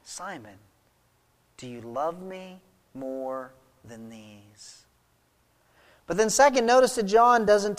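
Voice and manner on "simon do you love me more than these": very soft, low and slow; hushed question. Acted. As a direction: let each phrase land quietly after a pause. Acted Jesus asking Peter, hushed.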